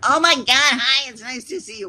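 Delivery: loud, high bubbly hi; mock gushing greeting. Streamer mocking another streamer greeting chat, hammed-up excitement. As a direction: imitate her sudden cheerful welcome. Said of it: "she started off".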